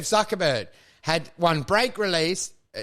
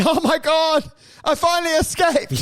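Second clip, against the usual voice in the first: frantic escaped robot; 10 semitones higher, 4 dB louder than usual. Pretend. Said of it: "runs onto the street and going".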